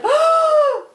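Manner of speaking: very high, drawn gasped oh; crowd's shocked gasp. Acted. Everyone sees the boy fall off, high shocked gasp. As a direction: gasp high in acted horror.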